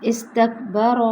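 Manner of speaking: ordinary talk, nothing marked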